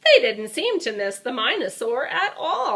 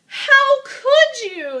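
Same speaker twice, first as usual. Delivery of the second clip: much higher, louder, slow cry; yelling; 7 dB louder, much slower than usual. Acted. Dinosaur yelling at the one who wrecked the tower, high and loud. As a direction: shout it in disbelief. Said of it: "yelled Iguanodon".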